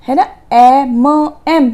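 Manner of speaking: ए म एम split into slow separate sounds; sounding out slowly. Shown as a demonstration. Teacher to students, sounding out letter M: ए म एम slowly. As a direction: break it down: ए, then म, then एम. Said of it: "हमें ए म एम की sound आती है".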